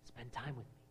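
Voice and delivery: soft whisper of the Lord. Acted. Preacher voicing the Lord whispering to you, softly. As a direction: hush it as God's whisper. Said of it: "The Lord is whispering to you".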